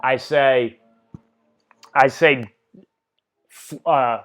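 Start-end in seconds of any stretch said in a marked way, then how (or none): none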